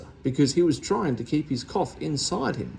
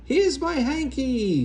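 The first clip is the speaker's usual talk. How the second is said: higher, slow delighted cry; 11 semitones higher, 3 dB louder, slower than usual. A narrator's reading. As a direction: beam as you show it off. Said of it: "beamed with delight".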